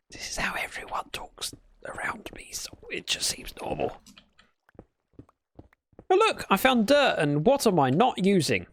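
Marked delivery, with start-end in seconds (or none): 0.1-4.0 very soft, whispered explanation; hushed aside. Retold or imitated. Sheepish reply to retold admirers.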